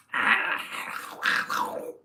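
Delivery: wordless throaty duck squawk; bad Donald Duck. Shown as a demonstration. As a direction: have another hopeless go at quacking. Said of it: "It's not a Donald Duck voice at all".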